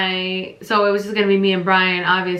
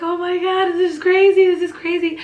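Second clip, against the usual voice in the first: panicked disbelief; 9 semitones higher than usual. Retold or imitated. Retelling panic as labor starts.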